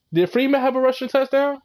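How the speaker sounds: normal talking voice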